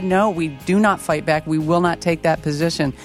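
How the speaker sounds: ordinary talk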